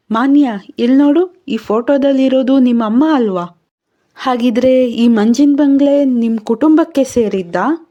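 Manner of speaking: stunned by the photo; startled discovery. Acted. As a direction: Vikram showing Manya her mother's photo, startled. Vikram finds mother's photo.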